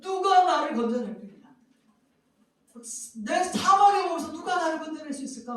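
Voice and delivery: high, loud despairing cry; anguished lament. Acted. Preacher voicing Paul's despair, a loud anguished lament. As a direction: wail it out as if lost. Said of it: "탄식하는 거거든요".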